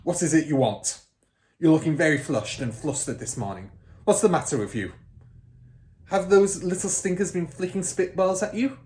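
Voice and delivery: loud, overbearing headmistress; impatient headmistress interrogating a young teacher; Trunchbull. Acted. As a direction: boom at the timid teacher. Trunchbull voiced in story.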